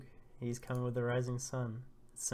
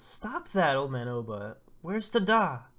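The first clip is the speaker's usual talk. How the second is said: urgent protest; 3 semitones higher, 8 dB louder than usual. Acted. Lulu tries to stop old man Oba, urgent raised voice. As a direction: cry out in protest, pitch jumping up.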